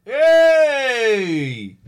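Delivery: loud, high cheer. Real, not acted. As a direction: whoop with glee. Cheering the twelve-year-old caller.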